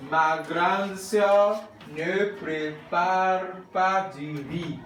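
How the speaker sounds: slow, stretched-out syllables; spaced out for repeating; slow model reading. Shown as a demonstration. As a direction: model it slowly for the class. Models French slowly for class to repeat.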